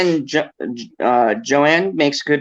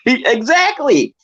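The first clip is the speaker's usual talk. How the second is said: laughing shouted agreement; 11 semitones higher, 3 dB louder than usual. Real, not acted. Agreeing loudly with the cohost mid-laugh, laughing shout. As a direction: yell it while still laughing.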